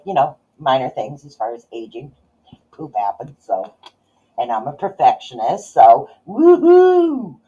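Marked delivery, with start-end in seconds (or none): 5.7-7.4 high, slow mock cheer; a wry little whoop at herself; sarcastic woohoo. Real, not acted. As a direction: ironic woohoo. Sarcastic cheer about perfectionism.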